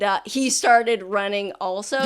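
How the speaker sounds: normal talking voice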